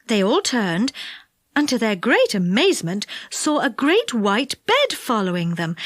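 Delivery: plain reading voice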